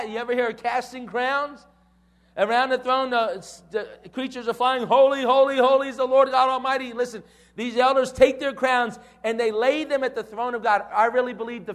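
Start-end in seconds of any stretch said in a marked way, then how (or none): 4.9-6.9 loud, raised acted cry of worship; proclaiming praise. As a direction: call it out like heavenly creatures praising. Acting out creatures crying holy.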